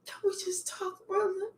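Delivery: high whispered plea; hushed, hammed-up pleading; mock begging. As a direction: whisper it like a desperate ex. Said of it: "I'm begging you".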